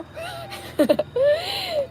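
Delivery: very high teary laugh-sob; real tearful laughing sob; laugh-cry. Real, not acted. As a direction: laugh helplessly through tears. Laughing while crying.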